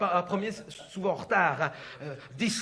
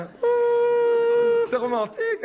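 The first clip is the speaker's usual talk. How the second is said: much higher, louder mock swoon; sarcastic; 14 semitones higher, 5 dB louder, much slower than usual. Wife mocking her wedding date, high sarcastic swoon. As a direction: gush it so nobody believes it.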